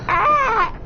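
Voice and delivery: very high laughing crow caw. Retold or imitated. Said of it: "Let me try one more time".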